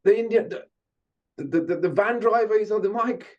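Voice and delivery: high, amazed shout-out; excited manager. Retold or imitated. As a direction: point him out, high and thrilled. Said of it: "He goes".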